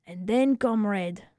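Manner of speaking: usual speaking voice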